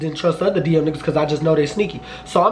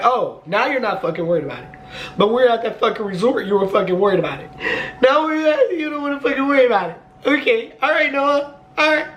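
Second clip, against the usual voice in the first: rising into a high, sarcastic mock; sassy mimicry climbing high; mocking sass; 6 semitones higher than usual. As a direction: throw his words back at him, higher and snippier each line. Mocking boyfriend in retold argument.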